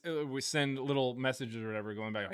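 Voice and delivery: normal talking voice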